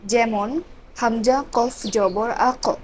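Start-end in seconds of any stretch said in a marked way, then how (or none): none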